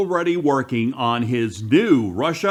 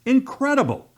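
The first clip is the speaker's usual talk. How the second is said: high-pitched burst of disbelief; incredulous exclamation; 11 semitones higher than usual. Real, not acted. Outraged reaction to the news just reported.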